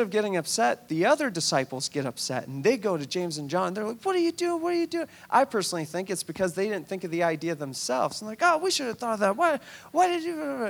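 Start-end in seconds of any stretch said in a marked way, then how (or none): 4.0-5.1 high, shrill-free indignant cry; indignant outrage. Acted. Upset disciples confronting James and John, high indignant. 8.4-10.7 high mock-jealous complaint; jealous whine. Disciples jealous they missed the idea, high whiny complaint.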